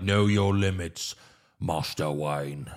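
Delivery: measured butler warning; Alfred scolding. Retold or imitated. Butler warning the master about his bruises, slow and low.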